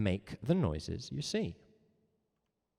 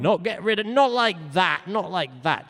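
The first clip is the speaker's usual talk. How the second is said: emphatic correction; 8 semitones higher, 8 dB louder than usual. Real, not acted. Protesting misheard words.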